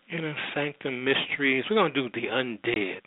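ordinary talk, nothing marked

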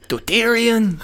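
put-on Spanish soap drama; hammed-up Spanish accent; sexy Spanish accent. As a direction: land the name like a Spanish telenovela reveal. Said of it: "My sexy Spanish accent".

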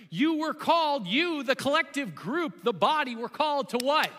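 preacher rising on called; emphatic preaching. Real, not acted. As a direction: build the question to the crowd. Reading scripture with emphasis.

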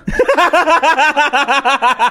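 long, high rattling laugh; helpless extended cackle. Real, not acted. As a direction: laugh on in a steady run. Laughing through the game joke.

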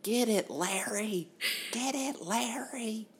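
high, slow cheering yell for Larry. Retold or imitated. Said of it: "I just kept yelling".

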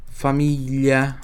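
Família drawn out very slowly; slow demo. Shown as a demonstration. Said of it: "G L I tem som de L H I". (Família bem devagar)

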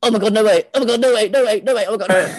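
high loud squealing disbelief; shrieking jackpot winner. Acted. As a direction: squeal like you just won big. Character hears caller, shrieks.

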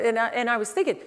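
normal talking voice

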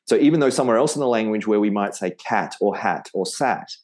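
normal talking voice

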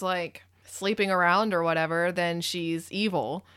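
ordinary talk

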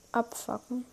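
ordinary talk, nothing marked

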